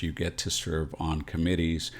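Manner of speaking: normal talking voice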